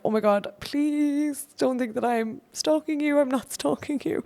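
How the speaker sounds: high, squeaky mock-frantic voice, giggling; mock panicked plea. Messaging Stephen before the show, a mock-frantic high plea. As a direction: play your frantic past self begging not to seem a stalker.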